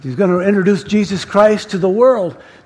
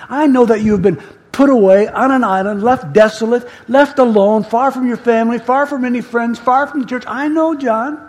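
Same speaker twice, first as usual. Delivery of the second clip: impassioned reassurance, building higher; fervent, raised voice of divine comfort; Jesus; 4 semitones higher than usual. Acted. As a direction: start measured, then pile up the phrases with rising urgency. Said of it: "that voice is talking to John".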